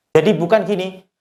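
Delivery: normal talking voice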